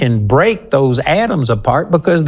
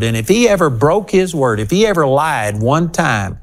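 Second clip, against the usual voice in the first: normal talking voice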